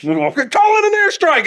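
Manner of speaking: very high, strained battle call; hammed-up squealed military order; pretend soldier. Soldier calling in pretend airstrike, high mock-urgent cry.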